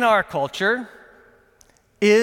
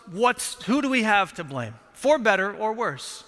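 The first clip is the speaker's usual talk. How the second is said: ordinary talk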